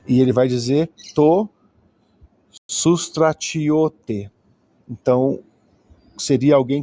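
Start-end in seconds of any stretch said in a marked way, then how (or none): 2.7-4.3 "sustratiote" spelled out syllable by syllable, very slowly; very slow Greek reading. Shown as a demonstration. Teacher reading the Greek text aloud to students, very slowly. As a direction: drag out "sustratiote" so each sound is heard. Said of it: "teacher reading the Greek word".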